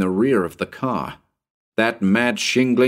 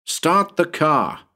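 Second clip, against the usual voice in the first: high, slow urgent shout; urgent command; 8 semitones higher, slower than usual. A narrator's reading. Narrator ordering Bill to start the car, slow urgent shout. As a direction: bark the order in a panic. Fleeing the ghost, urging Bill.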